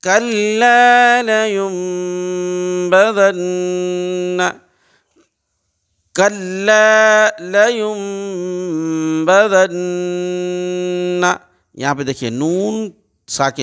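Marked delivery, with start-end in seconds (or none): 0.0-11.3 slow, drawn-out tajweed chant, raised pitch; Quranic recitation. Shown as a demonstration. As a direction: intone each syllable like a qari. Recites verse examples. (تجوید کے ساتھ آہستہ تلاوت)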